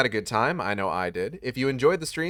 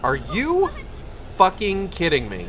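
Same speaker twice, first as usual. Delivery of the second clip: loud, higher, real exasperation; furious outburst; 6 semitones higher, 4 dB louder than usual. Real, not acted. Streamer yelling at the game, loud and higher. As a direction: yell it at the screen in disbelief.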